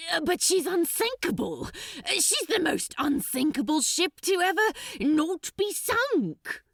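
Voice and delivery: high, fast flustered pleading; panicky Crowley. Acted. Crowley protesting the order to the archdemon, high and frantic. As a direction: babble your protest in a panic.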